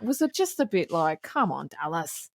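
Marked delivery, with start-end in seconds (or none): 1.2-2.3 slower, softer, let-down sigh of a line; a mild imitated exasperation, slow and soft; exasperated groan. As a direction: drag it out, disappointed. Exasperated quote at the captain.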